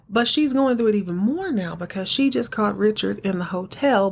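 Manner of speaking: ordinary talk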